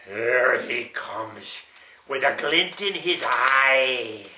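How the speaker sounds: slow, drawn-out suspense; suspenseful. Acted. Grandpa slowly building suspense as the croc creeps in. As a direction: stretch it out slowly to build tension.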